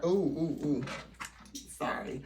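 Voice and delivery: ordinary delivery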